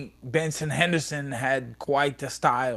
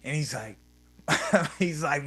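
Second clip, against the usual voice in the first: ordinary talk, nothing marked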